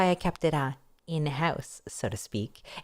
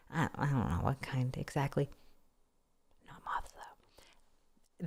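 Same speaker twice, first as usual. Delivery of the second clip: whispered aside; 4 semitones lower, 10 dB quieter, slower than usual. Acted.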